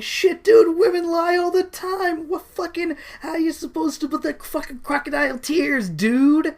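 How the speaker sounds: high-pitched, near-tearful exasperated rant; high whiny rant. Acted. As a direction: whine it like a fed-up commoner. Acted rant of the commoner.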